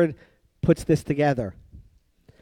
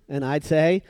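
ordinary talk

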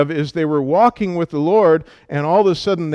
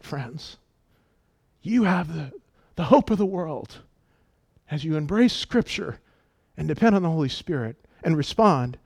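ordinary talk, nothing marked

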